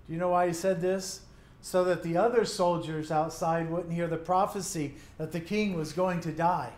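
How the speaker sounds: ordinary delivery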